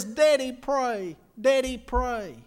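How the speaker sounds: put-on slow, pleading child's voice; a hint of a weak little boy begging. Dying boy begging his father.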